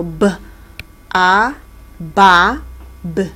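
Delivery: "b", "a", then "ba", each said separately; sounding out B. Shown as a demonstration. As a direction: sound out "b" plus "a" into a loud, clear "ba". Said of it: "it becomes a B sound".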